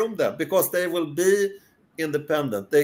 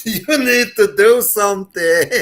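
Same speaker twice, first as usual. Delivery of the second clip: louder, higher wife's prodding, with laughter; mock urging; 6 semitones higher, 7 dB louder than usual. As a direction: laughingly imitate a wife's insistent push. Acting out wife urging him on.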